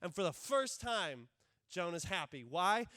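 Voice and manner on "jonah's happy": ordinary talk, nothing marked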